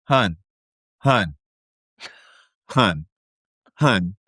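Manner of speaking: low, repeated mock interjection with pauses; interrupting Audrey. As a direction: cut in patiently, one low word at a time. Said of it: "her way to stop Angelica is like".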